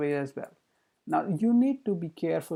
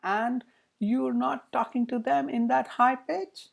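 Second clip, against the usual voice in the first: raised, slow high-pitched voice; high pitch demo; 7 semitones higher than usual. Shown as a demonstration. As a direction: push the voice up high to show it. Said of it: "talking in a very, very high pitch".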